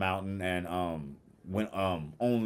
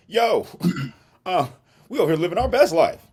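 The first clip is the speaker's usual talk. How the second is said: much higher and louder, laughing through it; gleeful boast; 11 semitones higher, 10 dB louder than usual. Retold or imitated. The friend brags to the boss, laughing, high and loud. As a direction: crow about it with a giggle.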